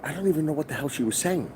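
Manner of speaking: usual speaking voice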